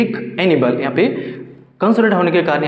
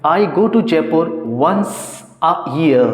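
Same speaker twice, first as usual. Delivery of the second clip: slow dictation; much slower than usual. Shown as a demonstration. Teacher dictates example sentence.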